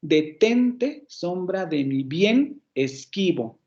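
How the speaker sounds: "Detente, sombra de mi bien, esquivo" with TEN, BIEN and QUI stretched; chant-like beat on TEN, BIEN, QUI in "Detente, sombra de mi bien, esquivo"; three marked accents. Shown as a demonstration. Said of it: "Tenemos tres acentos en ese patrón rítmico".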